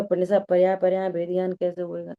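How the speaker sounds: quick, low-pitched asking; retold question. Student asking Pandit sahab how, retold quick question. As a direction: put the question in one fast run. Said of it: "तो पंडित साहब से पूछा".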